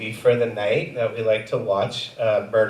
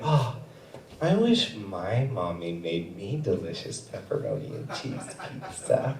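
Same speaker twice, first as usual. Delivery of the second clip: softer, passive-aggressive sigh; hint at it pointedly; wistful dig at the wife; 5 semitones lower, 10 dB quieter than usual. Retold or imitated. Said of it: "Very passive-aggressively".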